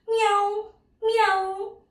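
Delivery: high-pitched imitated cat meow; teacher's high cat call. Shown as a demonstration. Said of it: "the cat says".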